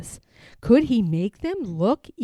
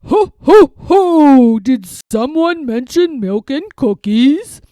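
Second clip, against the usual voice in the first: jolly Santa; 2 semitones higher, 13 dB louder than usual. Acted. Santa arriving at the party, booming and jolly. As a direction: boom in like Santa at the door.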